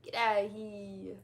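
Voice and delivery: loud, drawn-out New Jersey accent. Shown as a demonstration. Streamer's last try at the accent, stretched New Jersey. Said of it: "I can't do the accent anymore".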